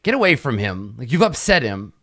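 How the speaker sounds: protective scolding. Acted. Tabitha scolds the player.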